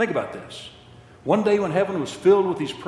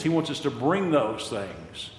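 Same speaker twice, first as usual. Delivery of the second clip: ordinary delivery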